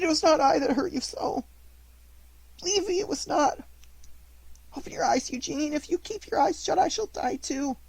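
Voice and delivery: high, tearful pleading; crying in despair. A narrator's reading. Pinocchio crying over his fallen schoolfellow, high and tearful. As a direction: beg him to wake, breaking with grief. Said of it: "crying bitterly in his despair".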